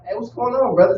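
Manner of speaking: high, light, easygoing hello; friendly brotherly greeting. Retold or imitated. To strangers pulling alongside, a friendly high greeting. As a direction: greet them like old friends, no threat. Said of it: "with an attitude instead of just like".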